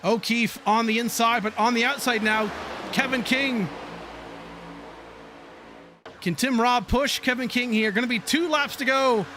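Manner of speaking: brisk race call building toward the finish; racing commentator calling the last laps; live play-by-play. Acted. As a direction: call the pack as it jostles for position.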